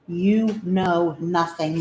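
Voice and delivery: "You know nothing" drawn out slowly, stress on "You"; slowed tease. Acted. Owner teasing her dog with a slow, drawn-out "You know nothing".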